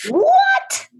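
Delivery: high-pitched shriek of surprise; real astonishment, pitch leaping way up; shocked disbelief. Real, not acted. Shocked reaction to the book's date.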